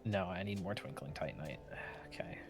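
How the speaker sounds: whispered to herself; whispering. Real, not acted. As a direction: whisper it under your breath. Muttering to self while gaming.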